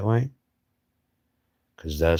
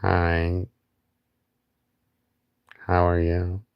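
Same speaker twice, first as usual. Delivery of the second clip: silly greeting voice; slower than usual. Pretend. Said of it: "I don't know why I do shit like that".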